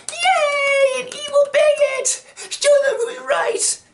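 very high, shouted fan cheer; mock gleeful cheering. Fans cheer against a straw man, mock glee. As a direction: squeal it like a hammed-up crusader.

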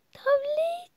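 thrilled at spotting the tablet; excited gasp. Acted. Daughter gasping with delight at finding her tablet.

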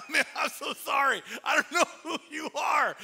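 loud, high, flustered apology; embarrassed apology. Retold or imitated. Pastor blurting an apology to a forgotten neighbor, loud and high.

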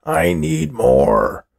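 slow, high, loud croaking demand; demanding old man. A narrator's reading. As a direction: drag out the need like a starving old coot.

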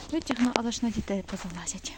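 whispered under her breath. Real, not acted. Woman whispers to herself while pulling off a tick. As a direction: whisper it while working on the dog.

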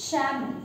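"Shaman" with no L sound; salmon as "Shaman", L left out; silent L in salmon. Shown as a demonstration. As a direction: model "Shaman", dropping the L before M. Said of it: "What we pronounce?".

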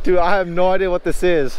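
loud and high, thrilled; excited. Real, not acted. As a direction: blurt it out, pumped up. Fighting unknown fish.

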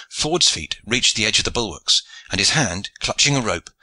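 ordinary delivery

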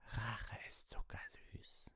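very slow, soft gloating whisper; hushed, drawn-out menace; breathed whisper. A narrator's reading. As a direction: breathe it out, savouring each word.

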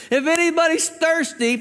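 high, carrying call to a crowd; crying out loudly. Retold or imitated. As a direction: lift the voice high and proclaim it. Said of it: "He cries out with a loud voice".

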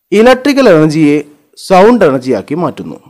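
normal talking voice

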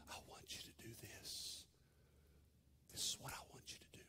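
breathy whisper, barely audible; whispered voice of God. Acted. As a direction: whisper it like the still small voice. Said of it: "still, small voice".

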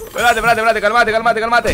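begging yourself to stay calm as the round slips; tense calm down. Real, not acted. Streamer urging himself mid-fight, tense and loud.

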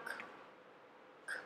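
K as a bare puff of air, no voice; voiceless k. Shown as a demonstration. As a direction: say K as a crisp consonant with no vowel after it. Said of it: "无声子音发k". (无声子音k，只送气不出声)

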